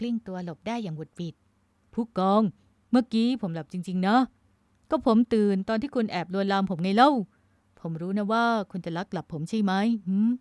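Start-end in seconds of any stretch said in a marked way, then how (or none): 1.6-10.3 loud playful protest; mock-innocent, raised voice; amused teasing. A narrator's reading. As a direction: tease her with a touch of laughter. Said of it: "ชายหนุ่มเอ่ยกลั้วหัวเราะ".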